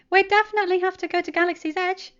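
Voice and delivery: can't wait; excited Peter. A narrator's reading. Peter plans rides with Wanda, high eager voice. Peter poring over the map.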